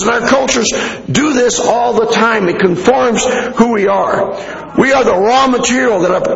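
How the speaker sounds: normal talking voice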